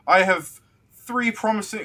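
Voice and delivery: high, loud proclamation; announcing officer. Acted. Officer presenting recruits to the guard, loud high announcement. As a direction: proudly declaim it at high pitch. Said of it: "the low calf will go".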